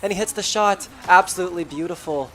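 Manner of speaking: loud, fast play-by-play build; excited. Real, not acted. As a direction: call the shot with rising hype. Caster calls the winning shot.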